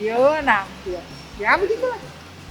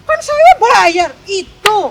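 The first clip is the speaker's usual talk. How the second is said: louder, slow, indignant mock of a rude passenger; retold angry customer, higher and louder; 8 semitones higher, 11 dB louder, slower than usual. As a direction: lean on it like she's paying so she owns you. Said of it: "Dengan, dengan nada yang marah gitu".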